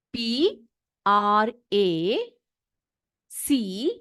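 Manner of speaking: each letter spaced out, very slow; spelling out slowly. Shown as a demonstration. Teacher spelling a word for her class, very slow. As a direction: spell it slowly so children can copy. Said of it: "practice".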